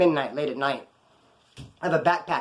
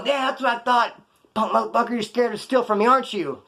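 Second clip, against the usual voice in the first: higher-pitched jeer at fleeing attackers; retold cocky heckling, pitched up; defiant taunt; 6 semitones higher than usual. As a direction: taunt them as they drive away.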